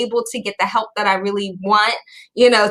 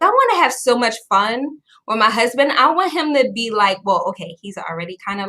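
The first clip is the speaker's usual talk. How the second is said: ordinary talk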